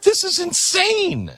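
high, slow mock astonishment, imitating the visitor; mock disbelief. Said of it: "He's like".

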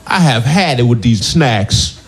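angry impression, slow and emphatic; slow, pissed-off movie star voice; Samuel L. Jackson. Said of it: "my impression of Samuel L. Jackson".

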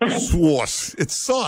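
"Sauce" stretched high in mock New York fashion; mocking her sauce. Shown as a demonstration. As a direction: send up her drawn-out "Sauce". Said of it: "three syllables in there".

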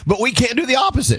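indignant outburst. Real, not acted. Indignant question to the room.

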